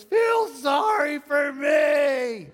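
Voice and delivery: put-on slow, high and loud crying kid's wail; dad mimics his crying son begging his mother for pity; whiny child imitation. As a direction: imitate a sobbing child's demand. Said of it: "he looks at his mother and he goes".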